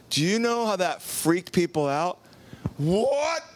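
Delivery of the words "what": high, indignant outburst; outraged protest. Acted. Empörte Zuhörer.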